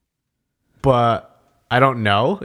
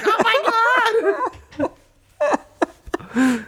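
mock amazed echo. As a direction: stretch out each word in pretend astonishment. Acting out amazed coach echoing fighter.

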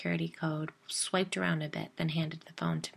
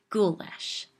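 a sharp, disgusted spit of a word; spat; 3 semitones higher, 6 dB louder than usual. A narrator's reading. Narrator snapping at Jill in disgust, spat. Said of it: "I spat".

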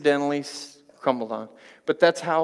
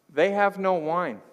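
higher, slow mock whine; whining; 6 semitones higher, 4 dB louder than usual. Jesus' mom announcing a social disaster, whining. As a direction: whine it long and high like a complaining mother. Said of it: "and she's whining".

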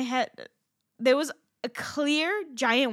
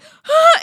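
a high, loud shocked gasp; sharp loud gasp; 16 dB louder than usual. Retold or imitated. Retelling the wound bleeding, a loud horrified gasp. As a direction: gasp in horror, loudly. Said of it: "and so I'm like".

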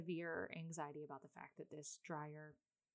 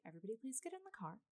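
forced calm; 4 semitones higher, much faster than usual. Retold or imitated. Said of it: "trying to heal me stopped".

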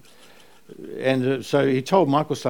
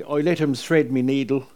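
Irish mum; 3 semitones higher than usual. Retold or imitated. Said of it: "Mum said she".

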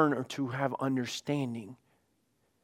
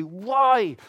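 acted high, drawn-out outburst; raised-voice exasperated plea; frustrated cry; 7 semitones higher, 4 dB louder than usual. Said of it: "you're just so frustrated with something".

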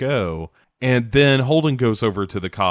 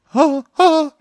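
mock high laugh; 4 dB louder than usual.

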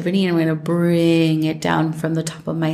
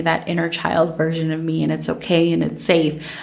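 ordinary talk

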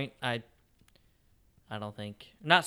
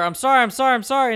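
louder, higher frantic shouted sorry; yelled apology; 11 semitones higher, 9 dB louder than usual. Retold or imitated. Driver shouting apologies at the woman he cut off, loud and frantic. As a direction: yell the apology out the window. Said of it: "he yells at her".

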